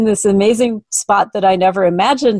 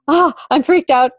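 higher, panicky quote of a rattled client; imitating a freaked-out client; 7 semitones higher than usual. Client arriving rattled, imitated in a higher panicky voice. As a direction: mimic the client's alarm, pitched up. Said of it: "arrive occasionally going".

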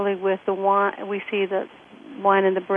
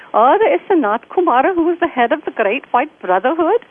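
higher, louder imitation Indian accent; 7 semitones higher, 6 dB louder than usual. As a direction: put on an Indian friend's lilting accent. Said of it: "Great Indian accent".

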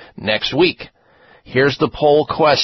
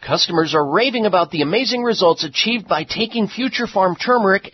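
upbeat ad read; infomercial; 4 semitones higher than usual. Acted. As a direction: sell it like a radio spot. Reads a sponsor ad.

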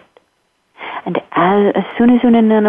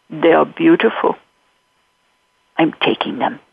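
elephant; 4 semitones lower than usual. Acted. Elephant claims the children.

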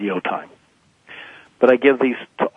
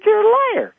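teasing high jab. Retold or imitated. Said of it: "I said".

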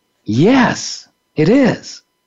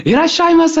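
high, laughing shouted welcome; mock shouted greeting; 12 semitones higher than usual. Sushi chefs shouting welcome at diners, high and laughing. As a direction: call it out like a sushi chef. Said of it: "they shout out".

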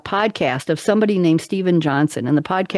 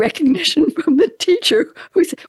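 ordinary talk, nothing marked

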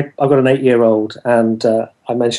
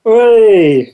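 cheer; 9 semitones higher, 5 dB louder than usual. Real, not acted. Host cheers demo.

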